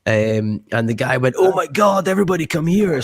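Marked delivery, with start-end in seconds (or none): 1.4-3.0 high-pitched shout-over to the crowd, retold; excited calling out. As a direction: call everyone over in a much higher voice. Bar man calls everyone over.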